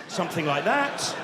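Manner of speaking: normal talking voice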